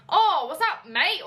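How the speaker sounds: half-landed Australian impression; weird Australian accent. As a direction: have a go at an Australian greeting, badly. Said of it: "it's a weird Australian accent".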